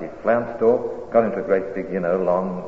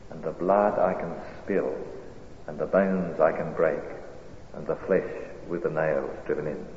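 poem recitation; 4 dB quieter than usual. Acted. Preacher reciting a poem to the congregation, hushed. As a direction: let each line settle before the next.

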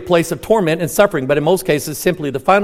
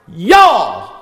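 Southern accent; 3 dB louder than usual. Shown as a demonstration. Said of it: "I lost my accent".

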